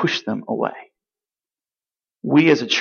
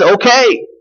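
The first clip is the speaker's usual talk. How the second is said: sarcastic okay; 8 semitones higher, 3 dB louder than usual. Retold or imitated. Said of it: "I hope you sense the sarcasm here".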